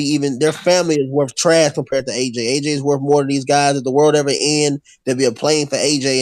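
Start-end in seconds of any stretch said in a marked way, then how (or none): none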